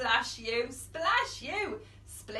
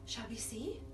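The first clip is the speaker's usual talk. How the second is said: whispering; 3 semitones lower, 7 dB quieter than usual. Acted. Suspense whisper.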